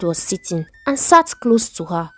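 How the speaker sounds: ordinary delivery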